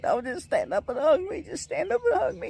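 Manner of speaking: very high squeaky voice begging for a hug; imitated excited plea. Boyfriend imitating his girlfriend begging him to stand up, squealing. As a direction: mimic a thrilled fiancée pleading. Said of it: "And she's like".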